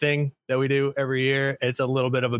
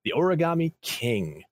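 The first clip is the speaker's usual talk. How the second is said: awards announcer; 4 semitones higher than usual. Acted. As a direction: present each title like a gala host. Announcing nominees like awards show.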